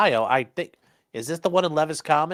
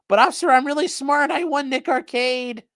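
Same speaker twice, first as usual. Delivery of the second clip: high, pleading nerdy voice; mock pleading; 12 semitones higher, 5 dB louder than usual. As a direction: whine it to the officer.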